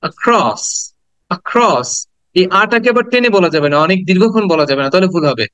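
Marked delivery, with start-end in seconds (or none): 0.0-1.9 "across" as "uh-KROSS", punching the "kross"; short "uh", then stressed falling "kross" in "across"; stress on second syllable. Shown as a demonstration. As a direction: stress the end of "across" after a brief first vowel. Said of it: "এটাকে একটু stress দিব".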